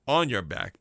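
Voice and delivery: normal talking voice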